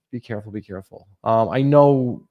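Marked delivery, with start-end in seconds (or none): 0.1-1.1 softer and softer each time; fading quiet repeat. Shown as a demonstration. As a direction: let the warning fade out. Repeats warning, fading away.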